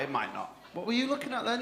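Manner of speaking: normal talking voice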